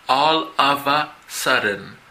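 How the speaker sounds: All of a sudden. drawn out slowly with the words joined; a slow teacher demo of All of a sudden. with linked words. Shown as a demonstration. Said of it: "cómo las palabras se unen".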